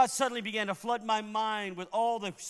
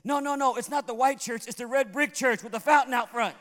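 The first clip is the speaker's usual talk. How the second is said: excited retelling; 3 semitones higher, 6 dB louder than usual. Retold or imitated. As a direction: imitate an excited husband correcting his wife. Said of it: "he gets all excited".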